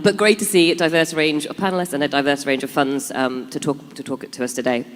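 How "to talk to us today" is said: usual speaking voice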